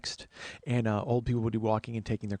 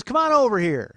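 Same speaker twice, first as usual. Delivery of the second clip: high, hollering lady voice; neighbor woman; 13 semitones higher, 10 dB louder than usual. Retold or imitated. Neighbor calling a TV guest over, high loud holler. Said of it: "She goes".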